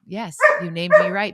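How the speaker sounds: high, loud voice for a dog; excited pretend dog reply; voicing the dog. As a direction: speak up playfully as the pet. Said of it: "yeah, Navy said".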